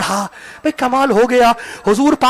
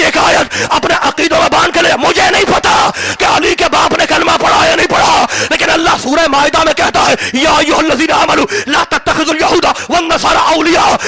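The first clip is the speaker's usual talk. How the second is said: full-force preaching; 3 semitones higher than usual. Acted. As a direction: thunder the point at the crowd.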